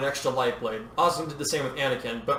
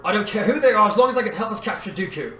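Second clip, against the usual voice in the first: raised, higher-pitched command; insistent commanding call; urgent order; 7 semitones higher, 5 dB louder than usual. A narrator's reading. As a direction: press the order forcefully. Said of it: "Obi-Wan ordered".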